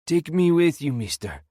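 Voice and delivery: high, loud begging cry; desperate plea. A narrator's reading. Old prisoner begs the warden to take him, loud desperate cry. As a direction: cry out to be taken along.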